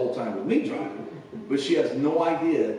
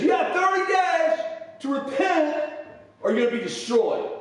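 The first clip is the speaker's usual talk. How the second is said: Jonah's doom shout; 13 semitones higher than usual. Retold or imitated. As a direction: bellow the warning to the city. Jonah preaching doom in street.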